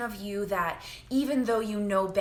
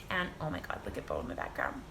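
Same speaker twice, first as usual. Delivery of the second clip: hushed, lower aside about the cat; quiet aside; 9 semitones lower, 8 dB quieter, faster than usual. Real, not acted. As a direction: murmur it off to the side. Distracted by cat on camera.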